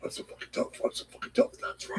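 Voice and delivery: unvoiced raspy Batman whisper. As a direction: rasp it like Batman. Batman parody.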